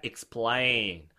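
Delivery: "explain" low, stress on the second syllable; model word. Shown as a demonstration. Teacher modelling for repetition, clear low "explain". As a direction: say "explain" as ik-SPLEIN. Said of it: "따라해봐요".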